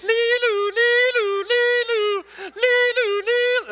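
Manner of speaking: high sung lee-loo siren wail; mock siren imitation; British police siren. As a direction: sing it like a European police siren. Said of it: "sounding like the British police".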